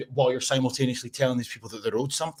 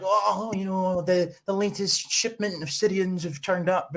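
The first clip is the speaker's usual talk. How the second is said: mock media voice; 8 semitones higher, slower than usual. Mocking the media's tone.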